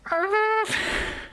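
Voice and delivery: sudden high-pitched laugh; high real laugh at her own joke; high squeal of a laugh. Real, not acted. Laughs at Fedora.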